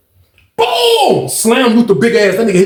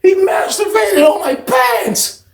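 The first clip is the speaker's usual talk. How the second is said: much higher, slow upset telling; officer telling coworkers what happened; distraught outcry; 11 semitones higher, slower than usual. Retold or imitated. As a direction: blurt it out, shaken. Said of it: "She was like".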